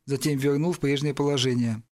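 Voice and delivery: ordinary delivery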